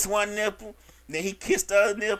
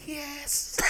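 higher, much softer, hammed-up pleasure; mock breathy yes; 6 semitones higher, 13 dB quieter than usual. Client answering the masseur, a hammed-up soft yes. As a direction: sigh the yes like you're melting.

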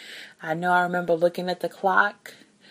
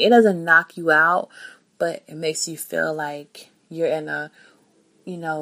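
usual speaking voice

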